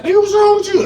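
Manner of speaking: very high, loud alarmed question; shocked daughter. Retold or imitated. Daughter seeing her dad high, shocked loud high question. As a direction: shriek it in disbelief. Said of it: "She's like".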